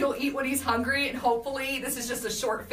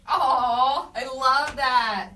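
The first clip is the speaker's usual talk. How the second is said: touched aww; 3 semitones higher, 6 dB louder, much slower than usual. Real, not acted. Touched reading a sweet comment.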